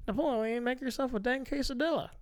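lightly voiced movie mom. Quoting the mom from Napoleon Dynamite, mock mom voice. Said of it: "if she's like".

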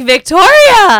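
loud high delighted cry; excited shout. Retold or imitated. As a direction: call her name in glee. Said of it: "And I was like".

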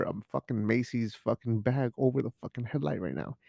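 ordinary delivery

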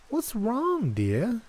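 high feigned concern; sugary worried granny; high old woman voice. A narrator's reading.

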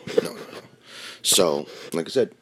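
usual speaking voice

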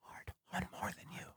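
fading whisper, almost silent; ASMR whisper. Shown as a demonstration. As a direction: breathe it out, ASMR style. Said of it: "Philly on A S M R commentary".

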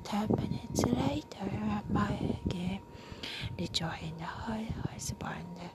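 soft unvoiced whispered narration; whispered story reading. Reading a novel aloud to a listener, whispered. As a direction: read it close and hushed.

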